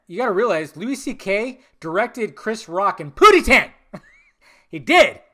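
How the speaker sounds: loud, high-pitched excited incredulity; real excitement, bursting with amusement; amused disbelief. Real, not acted. Amused amazement at a film fact.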